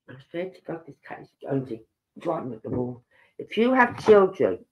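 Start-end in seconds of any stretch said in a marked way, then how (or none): 0.1-3.0 quiet, quick muttered aside; annoyed aside. Real, not acted. Speaker turns aside to scold her cat, quietly annoyed. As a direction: grumble under your breath at the pet.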